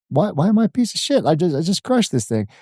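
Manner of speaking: incredulous. Retold or imitated. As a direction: protest the insult in disbelief. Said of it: "And I was like".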